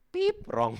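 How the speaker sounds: high, loud game-show buzzer then a flat wrong; buzzer beep. Pretend. Preacher buzzes out his own false confidence, loud beep. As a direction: buzz it like a quiz show error sound.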